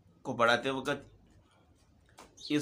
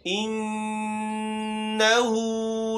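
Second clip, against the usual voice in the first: slow drawn-out Quranic chant; tajweed recitation; 2 semitones higher, much slower than usual. Shown as a demonstration. Teacher recites the closing verse, slow chant. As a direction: stretch it gently.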